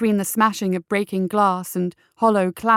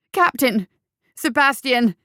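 raised, higher-pitched urgent yell; loud alarmed hollering; howled call; 5 semitones higher, 5 dB louder than usual. A narrator's reading. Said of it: "he howled at the top of his voice".

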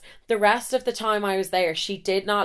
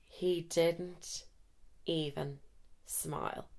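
deflated; 2 semitones lower, 14 dB quieter, much slower than usual. Real, not acted. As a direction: drop right down, word by word, as the joke dies. Deflated after her joke fell flat.

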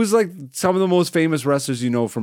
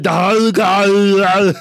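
garbled, drawn-out giant voice, louder; Andre the Giant; 6 semitones higher, 6 dB louder than usual. Acted. Podcaster mimicking giant wrestler's speech, garbled and drawn out. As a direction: slur the words into mush like a giant. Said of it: "He would be like".

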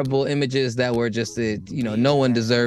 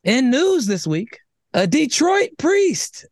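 mock-serious news bulletin; news read; 6 semitones higher than usual. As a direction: read the bizarre headline like a theatrical newscaster, landing hard on the key names. Reads out a news story.

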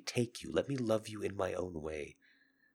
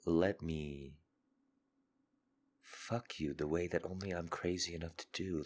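usual speaking voice

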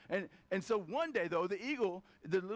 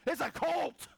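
scoffing chickens; 6 semitones higher than usual. Retold or imitated. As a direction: shout the eagle down like jeering chickens. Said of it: "the chickens are like".

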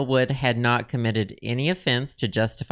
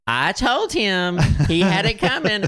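annoyed wife; 6 semitones higher than usual. Retold or imitated. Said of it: "her being annoyed up there".

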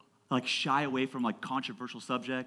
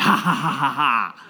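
loud, hammed-up belly laugh; acted laughing; 10 dB louder than usual. Pastor laughing at the teen's confession, acted laughing. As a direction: burst out in mock laughter. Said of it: "I just start laughing".

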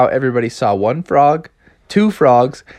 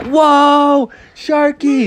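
amazed cheer; 12 semitones higher, much slower than usual. Acted. Frogs watching shark surf, amazed shout. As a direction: exclaim in high-pitched awe.

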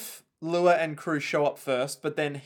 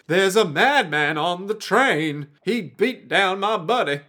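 slow, loud mock alarm; understated panic from the thugs; 4 semitones higher, 5 dB louder than usual. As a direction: report it slowly and urgently. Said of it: "they'll be all like".

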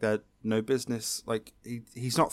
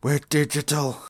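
higher, louder put-on astonishment; mock amazed reveal; 4 semitones higher, 5 dB louder than usual. Co-host re-enacting Izzy's big reveal, mock amazement. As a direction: announce it as a huge revelation, hammed-up. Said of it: "he does the big reveal".

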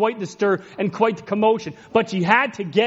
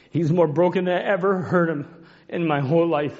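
ordinary talk

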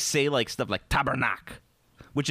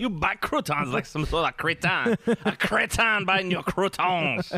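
put-on French accent; 6 semitones higher than usual. Mock French scolding.

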